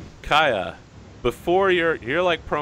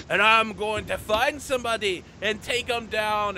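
high, hammed-up wrestler promo; Scowling Scotty; 10 semitones higher than usual. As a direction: deliver a mock boastful threat to the crowd. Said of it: "is like".

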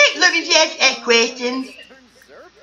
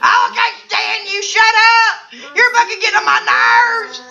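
exasperated yell; 9 semitones higher than usual. Pretend. Fed up with the contestants' chatter, yelling. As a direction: blow up at them in mock fury.

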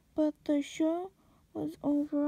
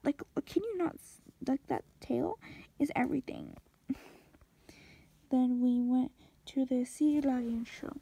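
normal talking voice

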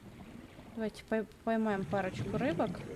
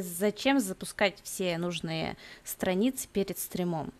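ordinary talk, nothing marked

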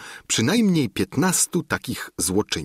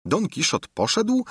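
usual speaking voice

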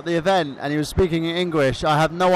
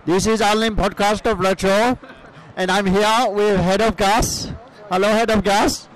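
put-on Iranian cousin accent. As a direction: play the foreign cousin with a thick accent. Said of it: "Michael Burhan's Iranian cousin".

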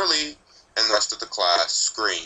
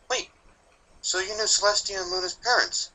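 put-on higher, curious young filly voice; eager filly; 11 semitones higher than usual. A narrator's reading. Scootaloo pressing the Reaper with a second question, higher and eager. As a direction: play a bold little filly.

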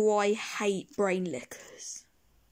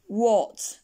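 usual speaking voice

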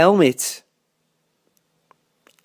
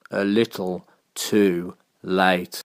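slow, low, soft let-down; deadpan, drawn-out anticlimax; slow punchline; 6 semitones lower, 6 dB quieter, slower than usual. A narrator's reading. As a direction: drop low and space out the words. Story punchline after the rush.

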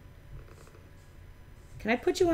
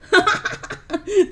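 loud, high burst of laughter; delighted laugh; 8 semitones higher, 7 dB louder than usual. Real, not acted. Player amazed at a very long fishing line, loud laugh.

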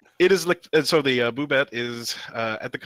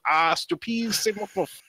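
mock French; 8 semitones higher, slower than usual. French line read in accent.

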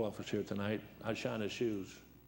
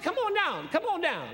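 very high, loud beckoning; judge's voice; 5 dB louder, slower than usual. Retold or imitated. Judge calling him up front, very high loud voice.

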